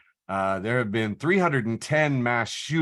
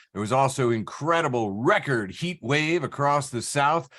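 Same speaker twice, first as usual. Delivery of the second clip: usual speaking voice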